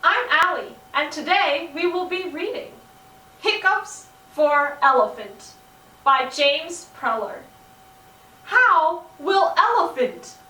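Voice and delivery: usual speaking voice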